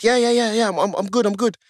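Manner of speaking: hurried reassurance. Retold or imitated. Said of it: "and I said".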